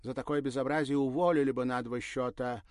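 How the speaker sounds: loud, high outraged lady; indignant. A narrator's reading. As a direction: scold the sloppy service loudly. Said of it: "никак не могла успокоиться".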